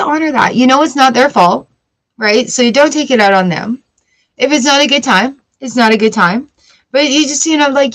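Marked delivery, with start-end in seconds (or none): none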